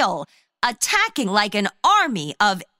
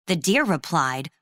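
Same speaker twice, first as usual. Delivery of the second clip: plain reading voice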